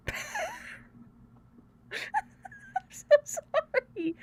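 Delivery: squeaky high, drawn-out apology through laughter; laughing apology. Real, not acted. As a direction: squeeze the words out softly while laughing. Helpless laughter while apologising.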